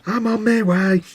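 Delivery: high, put-on obedient voice; mock compliance. Host mimics henpecked friend answering wife, mock compliance.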